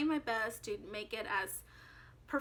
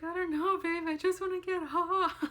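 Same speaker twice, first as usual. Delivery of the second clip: higher and louder, half-laughing panic; panicked; 6 semitones higher, 5 dB louder than usual. Retold or imitated. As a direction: plead to get off, laughing in fear. Panicking on the Ferris wheel.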